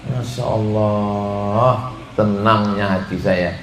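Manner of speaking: slow, low, drawn-out crooned masya Allah; blissful sigh. Retold or imitated. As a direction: savour it like a happy sigh. Blissful over being fed.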